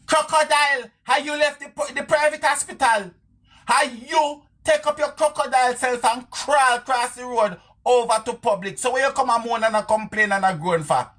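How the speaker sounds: very high-pitched taunting rebuke; mock scolding. Scolding the woman as crocodile.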